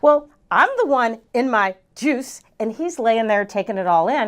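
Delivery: normal talking voice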